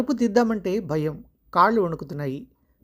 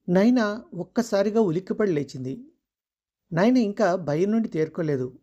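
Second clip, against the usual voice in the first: ordinary delivery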